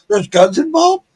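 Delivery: high rising alarmed echo; startled question. Retold or imitated. Echoed back as a startled question.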